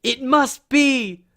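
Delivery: high, loud, slow proclamation; melodramatic reveal. Acted. Naming the villain from the note, melodramatic. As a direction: announce it with gasping, drawn-out drama.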